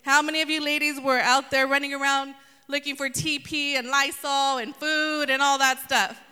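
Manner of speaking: ordinary talk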